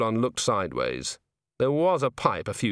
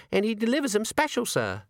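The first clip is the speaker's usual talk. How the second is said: put-on high young maid voice; 11 semitones higher than usual. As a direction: voice the nervous maid, higher.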